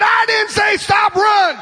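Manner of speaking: high, drawn-out mock order; retold command. Camp preacher ordering him to keep running, high mock shout.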